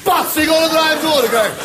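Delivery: high, hammed-up shout; shouted slap line. Storyteller delivering the repeated slap gag, shouting. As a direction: bellow it as a running gag.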